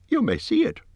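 a reasonable little hobbit making his case; Bilbo's voice. A narrator's reading.

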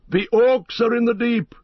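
high-pitched cry of alarm; Gamling. A narrator's reading. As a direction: cry out the warning from above. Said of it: "he cried".

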